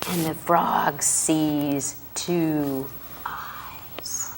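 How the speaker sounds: slow suspense whisper. Acted. Storyteller whispering the scary part to a child. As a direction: draw it out in a hush.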